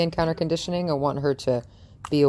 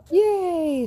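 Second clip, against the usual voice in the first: high squeal of praise; excited cheer; 3 dB louder than usual. Real, not acted. Trainer rewarding her dog with a high, excited yay.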